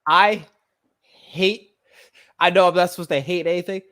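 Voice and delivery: bursting out at a mindset she hates; heated outburst. Real, not acted. Outburst about pastor's mindset.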